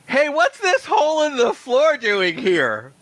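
high, slow creature voice; tick man. Acted. Tick man finds hole in dungeon floor, high creature voice. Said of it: "it's a tick man".

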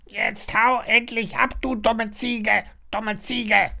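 high squawked insult; high-pitched rude bird voice; calling parrot. A narrator's reading. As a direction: squawk it slowly and repeat like a parrot. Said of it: "ruft der Papagei".

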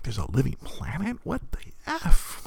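squeaky rise into a stunned whisper on the last words; a kid finding out about a living planet, stunned; shocked disbelief. Retold or imitated. Stunned kid reading comics, gasping in disbelief. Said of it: "I'm like".

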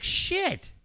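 high-pitched mock dismay. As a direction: imitate a crying little boy in a high voice. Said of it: "Bo's crying 'cause he's looking at his dad".

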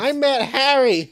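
high, loud, drawn-out mock announcement; over-the-top comic interjection; hammed-up outburst. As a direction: blurt it high and slow with a laugh. Hammed-up interruption after Harry joke.

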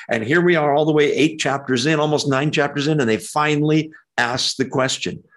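ordinary talk

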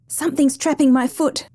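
sudden alarm, pitch up without shouting. Acted. Drowning woman feels rush of fear.

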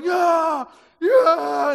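loud, high whooping shouts; battle shouts. Retold or imitated. Army shouting the war cry to David. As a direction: whoop like soldiers cheering. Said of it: "He hears the shouting".

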